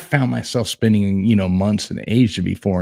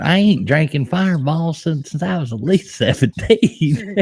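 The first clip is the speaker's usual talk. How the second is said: higher, teasing Southern twang; mock Southern twang, pitched up; mimicked country accent; 6 semitones higher than usual. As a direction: imitate her country accent with relish. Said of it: "didn't have a country accent".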